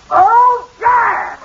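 loud, high holler; raucous high-pitched jeer; heckling shout. Acted. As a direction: yell it out from the audience. Heckles from the audience.

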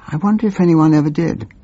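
ordinary talk

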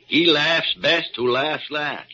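loud, slow and pointed; slow triumph. Acted. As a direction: savor each word. Doctor savoring triumph.

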